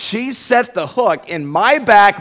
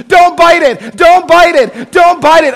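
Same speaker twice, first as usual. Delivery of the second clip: high, loud urgent pleading; pleading with a fish; 12 semitones higher, 11 dB louder, slower than usual. Retold or imitated. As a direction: beg the fish loudly not to bite. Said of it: "she started to talk to the fish".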